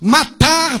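ordinary talk, nothing marked

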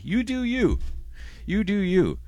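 high sing-song shrug-off; sing-song dismissive. Real, not acted. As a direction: toss it off lightly, high.

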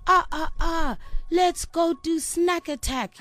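high-pitched, drawn-out mock rallying cry; stretched, high, eager announcement; excited retold suggestion. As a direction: act out a kid excitedly proposing mischief. Retells own excited sleepover suggestion.

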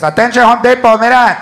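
put-on loud Chicano accent over the store speaker; Chicano store announcement. A Home Depot worker announces drills over the store intercom in a Chicano accent. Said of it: "even announcements have accents".

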